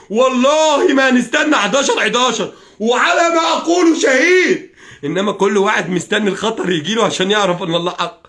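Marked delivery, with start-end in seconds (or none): 0.1-2.5 والله drawn out high and loud; heated, insistent vow building from والله; escalating oath. Real, not acted. Same oath again, escalated. 2.8-4.7 high, drawn-out cry of defiance; real impassioned vow, ringing out; shouted oath. Real, not acted. Climax of the oath.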